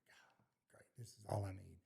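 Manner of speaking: very soft, nearly whispered grumble; retold under-the-breath complaint; muttered aside. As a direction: mutter it to yourself as a weary thought. Retold private exasperated thought.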